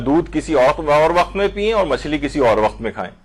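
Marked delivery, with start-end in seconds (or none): none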